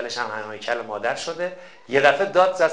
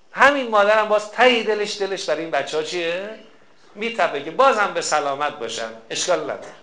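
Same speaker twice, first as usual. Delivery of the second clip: ordinary delivery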